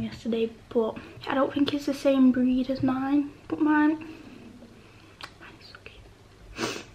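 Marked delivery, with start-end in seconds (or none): 4.0-6.8 a slow breathy whisper, barely voiced; drawn-out hush, leaning in close. Real, not acted. Whispering slowly about how cute her hamster is.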